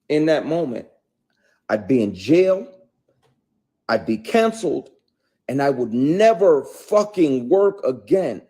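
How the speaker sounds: loud, high, heated outrage; furious rant. Real, not acted. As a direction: let the outrage boil over. Furious rant about harassment.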